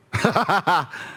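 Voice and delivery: short, high jeering cackle; mocking laugh. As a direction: laugh in scorn at a prisoner. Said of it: "mocking him".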